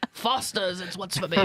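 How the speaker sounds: badly faked Aussie ad slogan; mock Australian, played for laughs; bad Australian accent. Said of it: "I can't do an Australian accent".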